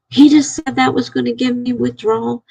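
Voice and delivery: mild mock yell at her; retold yelling. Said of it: "I started yelling at her".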